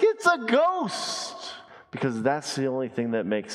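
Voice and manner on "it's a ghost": high, slow, spooked cry; mock terror. Disciples in the storm, hammed-up terrified cry. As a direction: act out the disciples' fright. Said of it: "they're like".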